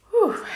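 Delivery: a high, loud exhale of release; relieved whew. Real, not acted. Vlogger shaking off tears before returning to makeup, loud relieved whew. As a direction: blow out the tension with a big whew. Shaking off emotion, resetting herself.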